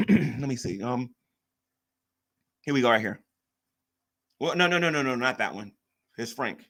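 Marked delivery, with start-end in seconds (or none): none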